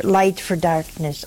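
ordinary delivery

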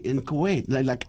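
usual speaking voice

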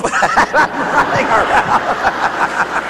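normal talking voice

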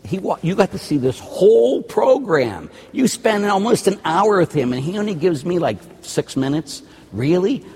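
high-pitched playful complaint; mock indignation. Priest complains to viewers about his airtime, mock-indignant. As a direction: protest as if hard done by.

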